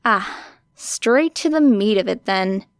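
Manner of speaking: ordinary delivery, nothing marked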